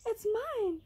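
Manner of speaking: high, chirpy little bird; high storybook bird voice; twittering bird. A narrator's reading. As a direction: pipe up from the treetop. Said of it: "the bird twittered from high in a tree".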